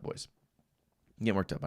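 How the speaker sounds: usual speaking voice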